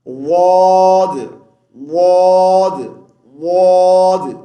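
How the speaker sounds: واد said slow, loud and repeated; drilled sound. Shown as a demonstration. Teacher drills the waw-dad join for students. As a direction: stretch out واد each time. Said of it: "This is".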